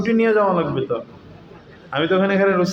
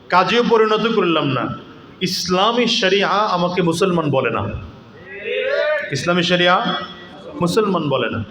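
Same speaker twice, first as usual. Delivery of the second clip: raised, rousing sermon voice with shouted peaks; fiery waz preaching; 4 semitones higher than usual. Acted. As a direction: preach it out to the crowd with rising force. Preacher builds to full force.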